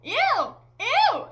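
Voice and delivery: loud, high "ew" of disgust; hammed-up cry of disgust; disgusted outburst. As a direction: recoil out loud, mock revulsion. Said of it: "I just kept saying".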